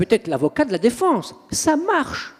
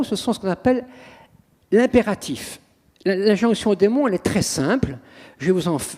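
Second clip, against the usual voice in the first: usual speaking voice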